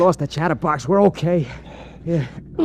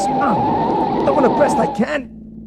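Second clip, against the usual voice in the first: panicked driver; much slower than usual. Acted. Driver under gunfire.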